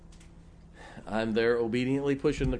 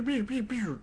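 imitated laser shots; 9 semitones higher, 3 dB quieter than usual. Defenses going down like shots.

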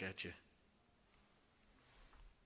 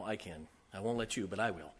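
usual speaking voice

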